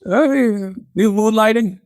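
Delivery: a hint of a patient dock worker; lightly voiced worker. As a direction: answer as if it's obvious. Worker answers in commercial.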